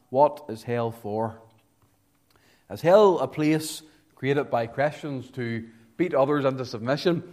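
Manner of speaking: ordinary talk, nothing marked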